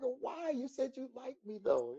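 mock whine. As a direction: whine like a kid who was told no. Whining at Luther's refusal.

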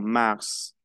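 mars with a sounded final S; final S pronounced. Shown as a demonstration. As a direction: let the S at the end of mars be heard. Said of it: "Le pronuncio la S final". (mars con la S final pronunciada)

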